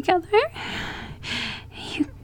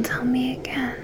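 whispered plea; 12 dB quieter than usual. Acted. Escalating demand from whisper.

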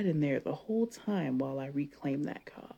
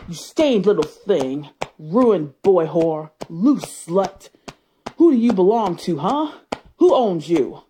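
loud, possessive shouting at her husband; domineering shouts; 10 dB louder than usual. Acted. Wife shouting ownership at her husband in bed, loud and possessive. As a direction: bark degrading names at him, louder and louder.

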